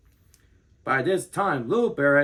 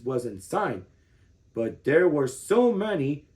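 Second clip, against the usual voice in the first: ordinary delivery, nothing marked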